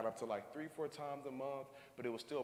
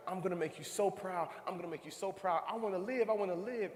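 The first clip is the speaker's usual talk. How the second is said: put-on girl's fervent vow; 6 semitones higher than usual. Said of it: "she's just like".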